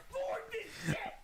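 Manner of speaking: short laugh that jumps up high. Real, not acted. Laughing at his own joke about fictional women, a high burst.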